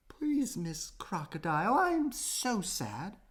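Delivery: high, soft little plea; a small kangaroo close to crying; sad pleading. A narrator's reading. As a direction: beg sadly, almost in tears.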